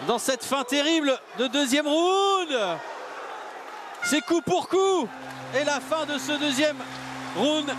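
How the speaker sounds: high, slow climactic round call; excited commentator. Real, not acted. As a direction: build the end of the round.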